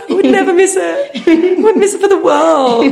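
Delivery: high, hammed-up party squeal; mock excited shout. Popping out of a birthday cake, mock excited. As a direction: call it out high, half laughing.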